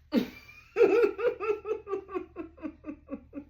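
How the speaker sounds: high, loud laugh falling away; long loud laugh. Real, not acted. Reader laughing at how irked she gets, long loud laugh. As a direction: laugh hard at yourself. Laughing at her own anger.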